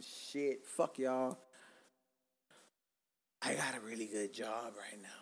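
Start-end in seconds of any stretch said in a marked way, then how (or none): 3.4-5.1 whisper. Real, not acted. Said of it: "whispered aside".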